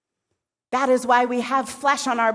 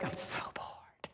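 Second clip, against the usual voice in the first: hushed whisper of boredom; 17 dB quieter than usual. Acted. Shakti complaining to Shiva, bored whisper.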